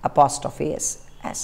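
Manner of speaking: normal talking voice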